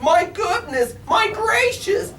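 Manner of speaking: very high, loud astonishment; amazed shout. A narrator's reading. Crowd marvels at the elephant bird, high loud amazement. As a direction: gasp it out in delight. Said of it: "they shouted".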